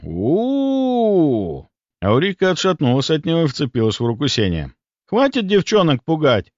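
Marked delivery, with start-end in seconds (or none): 0.0-1.7 high, long mock-ghost "ooo"; ghostly howl. A narrator's reading. Boy scaring the girls for fun, howling. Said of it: "и завыл". 5.1-6.5 higher-pitched, mildly indignant protest. A narrator's reading. Boy defends the frightened girls, indignant. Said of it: "возмутился Сеня".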